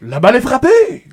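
excited mock play-by-play, loud and high; over-the-top commentator's shout; baseball announcer. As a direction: belt out the call, stretching the last word. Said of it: "il annonce ce que tu vois".

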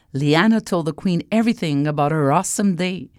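ordinary delivery, nothing marked

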